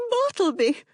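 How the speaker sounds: high, urgent woman's protest; a wife swearing her innocence; desperate wife. A narrator's reading. Wife protesting to husband, high and desperate.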